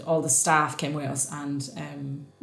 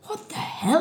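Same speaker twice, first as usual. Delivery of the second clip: baffled friend; 9 semitones higher, 4 dB quieter, slower than usual. Retold or imitated. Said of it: "she was like".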